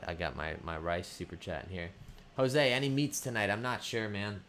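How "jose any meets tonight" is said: usual speaking voice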